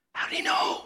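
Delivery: stunned stage whisper; astonished whisper. Acted. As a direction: whisper it in amazed disbelief. Acting out a startled scribe.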